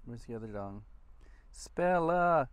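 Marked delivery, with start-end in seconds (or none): 1.5-2.5 calling out. Real, not acted. Calling the dog.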